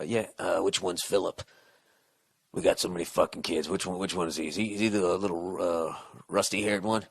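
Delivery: low, quiet, dopey mumble of a clueless dad; clueless husband. Retold or imitated. Mocking a husband who forgot which kid is Philip, low dopey mumble. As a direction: mumble it like a dad who can't name his kids.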